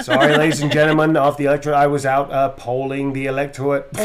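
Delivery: loud politician addressing the crowd; bad JFK-style oratory; Diamond Joe Quimby. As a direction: make excuses to the voters, loudly, in a bad Kennedy accent. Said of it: "I like doing, uh, Diamond Joe Quimby".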